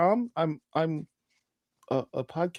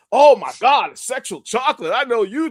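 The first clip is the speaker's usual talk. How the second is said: excited mock fan; 4 semitones higher, 9 dB louder than usual. Fan excitedly greeting Chocolate.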